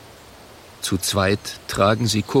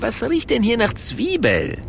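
higher, puzzled sniffing question; Italian barman voice; 11 semitones higher than usual. Acted.

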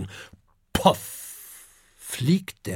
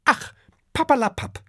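much higher, brushing-off papperlapapp; dismissive mother; 11 semitones higher, faster than usual. A narrator's reading. Dragon mother dismisses Herbert with a high papperlapapp. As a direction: wave his worry away. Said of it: "erwidert Adele".

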